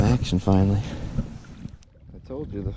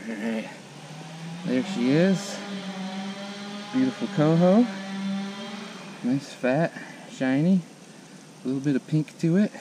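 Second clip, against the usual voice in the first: softer, hushed praise for the fish; soft admiring; 10 dB quieter than usual. Real, not acted. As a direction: murmur quietly over the catch. Admiring the catch up close.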